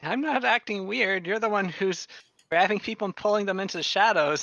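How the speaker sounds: defensive guard. Acted. Guard accused of acting weird, defensive. Guard figure protesting to his questioners.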